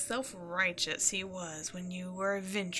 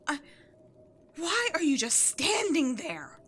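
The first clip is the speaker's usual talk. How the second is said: shocked outrage; 7 semitones higher, 6 dB louder than usual. Acted. Minion refuses to obey.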